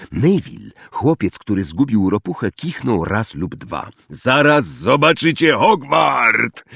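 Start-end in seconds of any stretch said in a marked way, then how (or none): 4.1-6.6 high, slow, loud call; shouted over shoulder. A narrator's reading. Hagrid calling back to first-years on the path, shouting. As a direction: shout back to the group behind you. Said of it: "krzyknął Hagrid przez ramię".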